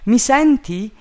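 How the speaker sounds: high, loud question; shouted call. A narrator's reading. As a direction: call up to someone far above. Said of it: "gridò il mio amico".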